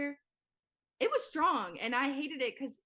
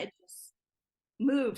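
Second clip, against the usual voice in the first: hushed, breathless "it just", then a punched, louder "moved"; dramatic pause before moved; 3 semitones higher, 6 dB louder, slower than usual. Real, not acted. Telling the host how fast the shoot went, with a dramatic pause. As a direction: hold the beat, then land "moved" hard.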